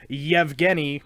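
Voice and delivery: Yevgeny with a Y sound at the start; player's own pronunciation. Shown as a demonstration. Announcer passing on the player's pronunciation, Yevgeny with Y onset. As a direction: begin Yevgeny on yev, though none is written. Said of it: "There's no Y at the beginning".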